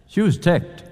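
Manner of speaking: usual speaking voice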